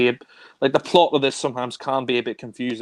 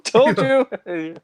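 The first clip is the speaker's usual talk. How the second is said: mock villain; 9 semitones higher, 4 dB louder than usual.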